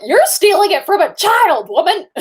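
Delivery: a high, outraged "woman" aimed at the villain; high indignant mock outrage. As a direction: pitch way up, mock-scandalized. Mocking outrage at stepmother.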